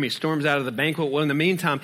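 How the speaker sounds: usual speaking voice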